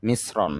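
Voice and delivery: "মিশ্রণ" with a plain s sound before the r; "মিশ্রণ" with the sh softened to s; demonstrated pronunciation. Shown as a demonstration. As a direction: say "মিশ্রণ" as "mis-ron", not "mish-ron". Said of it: "তালব্য স এর উচ্চারণ".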